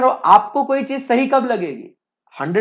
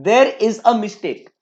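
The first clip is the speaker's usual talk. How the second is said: slow dictation; much slower than usual. Shown as a demonstration. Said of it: "teacher repeats slowly".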